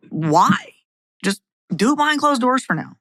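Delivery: ordinary talk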